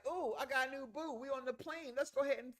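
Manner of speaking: high, airy imitation of the new girlfriend; mock girlfriend voice. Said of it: "she's like".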